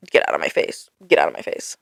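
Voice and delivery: whispered, irritated brush-off; annoyed whisper. Acted. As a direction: hiss it under your breath, fed up.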